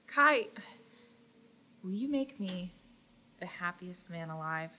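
soft, hushed marriage proposal; tender proposal. Acted. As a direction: ask softly, hoping for yes. Handsome figure proposes.

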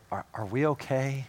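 soft, hesitant question to God; quiet, nervous plea for reassurance; timid hushed plea. Retold or imitated. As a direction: ask it like you're afraid of the answer. Sinner timidly asking God.

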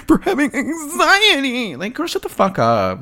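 words pushed high through a laugh; laughing disbelief. Real, not acted. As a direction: laugh through the whole line.

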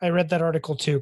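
ordinary talk

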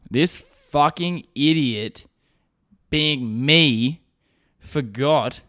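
slow, deliberate build, word by word; heavy pauses between each phrase; self-mocking emphasis. Real, not acted. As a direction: spell out your own stupidity slowly. Self-mocking punchline of the story.

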